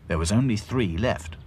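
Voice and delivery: plain reading voice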